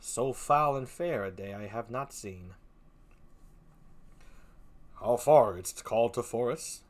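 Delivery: a hint of a stern nobleman; steady, grave Macbeth; lightly played Macbeth; lightly voiced Macbeth. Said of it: "Macbeth is like this".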